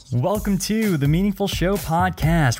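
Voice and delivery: podcast intro announcer. Acted. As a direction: pitch the show like a radio host. Podcast intro over music.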